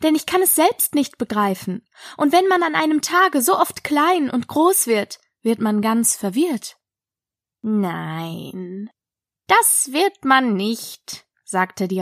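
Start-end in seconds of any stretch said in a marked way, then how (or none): 0.0-6.8 higher-pitched, polite Alice; polite, higher girl character. A narrator's reading. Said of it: "erwiderte Alice sehr höflich". 7.6-11.3 languid, ponderous caterpillar; contradicting caterpillar. Acted. Caterpillar flatly contradicts Alice.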